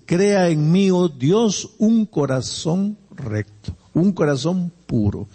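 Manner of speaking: normal talking voice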